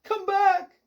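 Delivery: very high, loud, held call. A narrator's reading. Owner to his fleeing cat, calling out. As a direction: holler after a runaway pet. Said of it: "Mister Sim called out".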